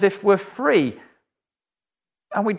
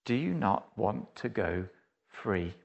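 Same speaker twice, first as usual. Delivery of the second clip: much lower and softer, sinking to a hush; soft earnest appeal; 9 semitones lower, 11 dB quieter than usual. Real, not acted. Preacher asking the congregation directly, low and hushed. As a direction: let the question land gently on each listener. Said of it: "the question that Jesus asks to all mankind".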